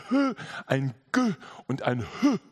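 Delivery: H and G sounded as bare consonants, not letter names; bare consonant sounds. Shown as a demonstration. Preacher demonstrating Hebrew consonants, H and G as bare sounds. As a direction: say H and G as short puffed sounds. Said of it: "sind Konsonanten".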